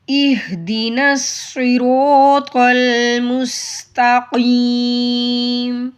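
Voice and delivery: slow chant, pressing the ya of وإياك; recite, leaning on the shadda; stressed recitation. Shown as a demonstration. Teacher reciting with the stressed ya. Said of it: "اسے پڑھیں".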